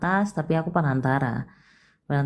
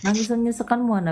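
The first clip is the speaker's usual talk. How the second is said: ordinary talk, nothing marked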